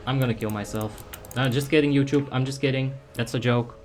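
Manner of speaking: normal talking voice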